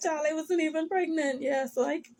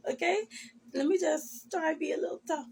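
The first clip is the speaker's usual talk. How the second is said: ordinary delivery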